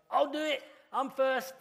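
high-pitched mock keen schoolboy; eager pupil. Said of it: "that guy who put his hand up".

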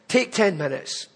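ordinary talk

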